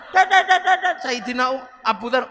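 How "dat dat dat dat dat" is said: higher, louder rapid nagging syllables; mock nagging chatter. Imitating a wife scolding Umar, rapid mock nagging. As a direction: rattle off nagging noises. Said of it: "lagi ngomeli Sayyidina Umar".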